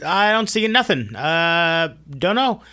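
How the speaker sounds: high, evasive hurry-off voice; brush-off routine. Retold or imitated. Prophet dodging the king's question, high and evasive. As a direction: dodge the question and edge out the door. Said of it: "tries the old ... routine".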